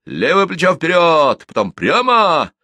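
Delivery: high loud parade command; shouting drill sergeant. Acted. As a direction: bark the turn order. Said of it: "отдал команду".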